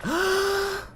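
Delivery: high gasping breath; gasp for air. Pretend. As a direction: suck in air like surfacing. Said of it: "I need some air".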